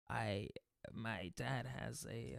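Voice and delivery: low, slow, hushed character voice; weird character voice. Shown as a demonstration. Invented character starts on his dad, weird low voice. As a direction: feel your way haltingly through a low odd voice. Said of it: "it goes like this".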